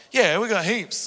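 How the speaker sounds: cheerful mum on the phone; mother's voice. Retold or imitated. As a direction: answer brightly as mum.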